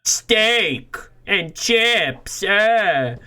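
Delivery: high, drawn-out groan of disgust at the steak; hammed-up disgust at a friend's dinner; mock disgust. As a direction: sneer at the plate, stretching steak and chips. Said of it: "and be like".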